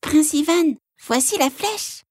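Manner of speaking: high tiny frog voice; small high froggy voice; little voice. Acted. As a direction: speak as a tiny frog calling. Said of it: "une petite voix qui disait".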